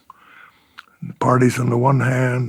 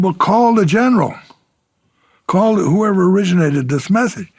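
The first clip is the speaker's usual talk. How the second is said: retold indignant demand, much higher; incensed; 7 semitones higher, 3 dB louder than usual. Incensed officer telling the lieutenant to call the general, indignant. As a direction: pitch it up in exasperated insistence. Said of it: "now I'm really getting incensed".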